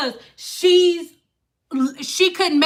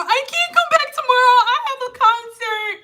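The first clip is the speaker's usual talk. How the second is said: mock crying; 10 semitones higher than usual. Client refusing to come back, high mock sob. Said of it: "her just sitting there crying".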